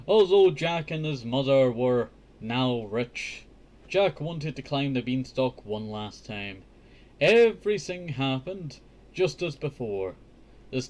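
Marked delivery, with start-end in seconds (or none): none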